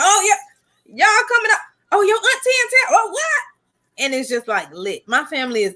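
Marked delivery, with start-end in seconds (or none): 0.0-3.2 high excited greeting voice; relatives greeting. Retold or imitated. Relatives greeting arrivals at the block party, excited. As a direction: squeal the welcome way up high. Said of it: "They be like".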